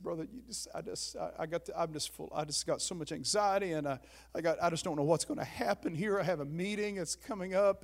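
small, faltering retold worry; meek anxious confession. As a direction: imitate yourself fretting quietly to a mentor. Retelling his anxious confession by phone.

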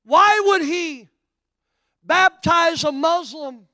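high, loud, slow preacher's climax; full-force sermon peak, loud and drawn out; preaching at full force. Acted. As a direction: drive each phrase home slowly to the crowd.

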